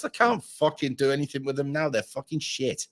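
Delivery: ordinary delivery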